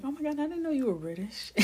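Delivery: high, quick mock astonishment; imitated amazement. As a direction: gush like an amazed fan, retold. Voicing amazed people in her story.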